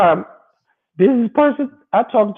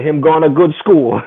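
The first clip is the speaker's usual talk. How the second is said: Jamaican patois; 7 dB louder than usual. Retold or imitated. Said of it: "as y'all Jamaicans say".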